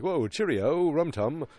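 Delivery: high, loud caricature Brit; mock British accent. British boss on the phone, mock British accent. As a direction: ham up a jolly British accent. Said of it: "the guy's like".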